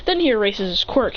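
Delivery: ordinary delivery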